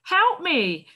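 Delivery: a hint of a lost child crying out; desperate cry for help. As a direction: call out desperately, voice up high. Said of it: "cried a young boy desperately".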